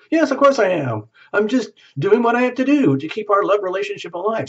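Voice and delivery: high, pushed-up defensive reply; defensive insistence. Pretend. Acted spouse defending their hard work to the partner, high and insistent. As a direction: insist a bit too hard that you're right.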